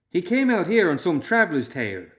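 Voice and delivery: put-on young man exploding in protest; burst out. A narrator's reading. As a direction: blurt it angrily at the group. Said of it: "Loomis burst out".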